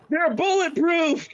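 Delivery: high, drawn-out mock scream of excitement; excited scream. Retelling a character testing his new arms, excited mock scream. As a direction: imitate an excited cry, stretching the words. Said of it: "all he screams is".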